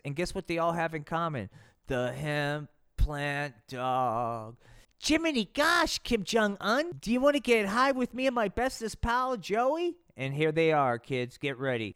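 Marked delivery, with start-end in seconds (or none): 5.0-9.9 put-on high eager gee-whiz boy; chirpy kid voice. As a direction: wholesome kid, naughty offer. [name] offering to get high.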